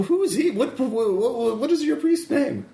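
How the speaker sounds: high-pitched, bewildered priest voice; confused priest. Acted. Game master as priest walking into interrogation, confused. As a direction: voice a priest utterly baffled by the scene. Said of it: "he's still very confused".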